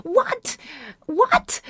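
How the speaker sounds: very high-pitched baffled yelps; high squeak of bewilderment; incredulous. Real, not acted. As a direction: ask it twice, voice leaping up in disbelief. Disbelief at the script.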